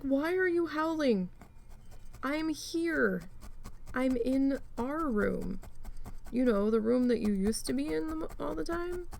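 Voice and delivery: high, slow talking-to a dog; exasperated scolding. Retold or imitated. Owner scolding her howling dog, high and exasperated. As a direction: scold the dog like a naughty child.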